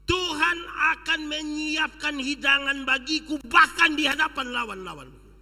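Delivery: full preaching. Real, not acted. Preacher proclaiming David's words, loud and fervent. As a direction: proclaim it with fervour.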